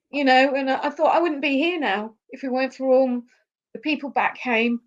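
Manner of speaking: choked up while thanking people back home; tearful thanks, voice lifted; emotional. Real, not acted. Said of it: "Sorry about that, I apologize".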